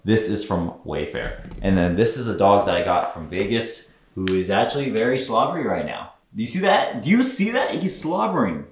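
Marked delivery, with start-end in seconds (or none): 6.3-8.7 much higher sing-song pet talk; talking to a dog. Real, not acted. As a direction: coo at the slobbery dog like a pet owner. Talking to his slobbery dog.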